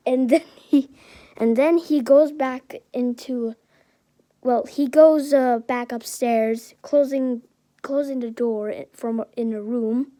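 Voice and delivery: plain reading voice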